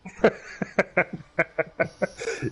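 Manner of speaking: airy high laugh; breathless laugh. Real, not acted. Nervous question makes him laugh.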